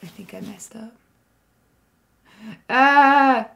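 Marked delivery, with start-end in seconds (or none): 2.7-3.5 frustrated yelp. Real, not acted. Stream setup just broke.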